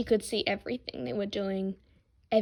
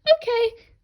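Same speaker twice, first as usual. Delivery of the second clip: much higher, louder squeaked okay; embarrassed prince squeaking; 14 semitones higher, 7 dB louder than usual. Acted. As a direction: squeak it out in panic. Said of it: "Zuko squeaked out".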